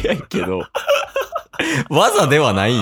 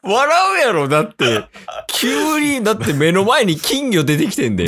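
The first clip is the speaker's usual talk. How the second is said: laughing; 10 semitones higher than usual. Real, not acted. Teasing the burp attempt.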